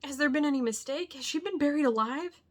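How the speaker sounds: loud, high, desperate questions; horrified cry. A narrator's reading. Arthur horrified at Van Helsing's words, loud high cry. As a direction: demand answers in horror. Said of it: "he cried".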